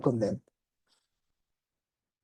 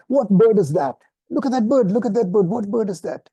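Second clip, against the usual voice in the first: put-on higher, louder, excited child asking; eager little kid; 6 semitones higher, 4 dB louder than usual. Teacher voicing a child in the park, eager and high. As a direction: pester like a curious kid. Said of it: "and a little kid asks".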